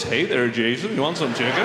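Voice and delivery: Colonel Sanders voice. Retold or imitated. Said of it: "I could hear his voice".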